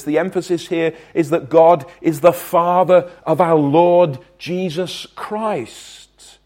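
"Lord Jesus Christ" drawn out very slowly; slow emphatic phrase. Shown as a demonstration. Preacher stressing whose Father God is, slow and deliberate. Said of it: "but here that's not the emphasis".

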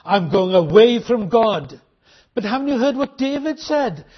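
normal talking voice

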